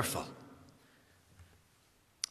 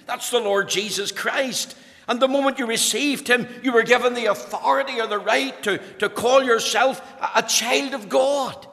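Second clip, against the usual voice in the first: higher, louder impassioned preaching; preaching at full force; 6 semitones higher, 3 dB louder than usual. Acted. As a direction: drive it home to the congregation.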